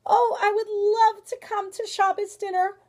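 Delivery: high, loud, eager acceptance; exclaiming. Acted. Bear accepting grandmother's dinner invitation, excited. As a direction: gush with eagerness to join in. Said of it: "exclaimed the bear".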